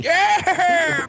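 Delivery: very high, drawn-out cheer; crowd screaming. Retold or imitated. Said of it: "We're all screaming".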